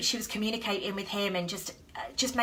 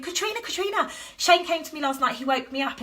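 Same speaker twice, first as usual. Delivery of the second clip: high, eager calling of "Katrina"; excited calling; 8 semitones higher, 4 dB louder than usual. Retold or imitated. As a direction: burst out with the news to the girlfriend. Excitedly waking girlfriend with news.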